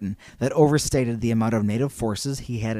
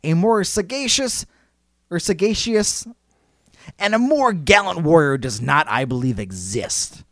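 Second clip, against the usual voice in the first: grand quotation; 4 semitones higher than usual. Acted. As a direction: proclaim it like a solemn tribute. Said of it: "what I'm about to read you".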